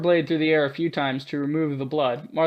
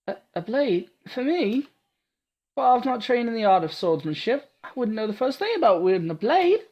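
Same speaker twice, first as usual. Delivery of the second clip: put-on high, eager girl's voice; awed young heroine praising her protector; timid young girl; 8 semitones higher than usual. Awed Ada gushing at Martha, high and breathless.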